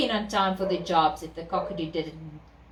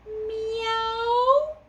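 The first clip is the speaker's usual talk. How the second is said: high meow rising; cat meow; 12 semitones higher than usual. Acted. Cat voiced.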